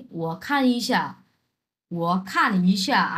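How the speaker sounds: very slow, syllable by syllable Chinese; slow classroom Chinese; slow model reading. Shown as a demonstration. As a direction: read it out slowly for learners. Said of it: "আমি বললাম কি".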